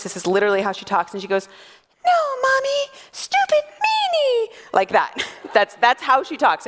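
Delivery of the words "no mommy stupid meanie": put-on tiny squeaky two-year-old, drawn out; super high-pitched toddler. Two-year-old daughter scolding her mom, super high-pitched voice. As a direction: squeak it way up high like a little girl. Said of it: "super high-pitched voice".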